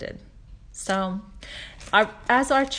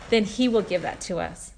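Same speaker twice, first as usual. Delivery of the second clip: usual speaking voice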